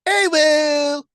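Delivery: high, loud, held awoo; wolf howl. Shown as a demonstration. Gives requested howl, then says done.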